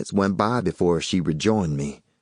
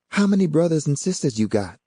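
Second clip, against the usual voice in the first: higher woman's voice; Yvonne's voice; 9 semitones higher, faster than usual. A narrator's reading. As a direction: read her lines a bit higher.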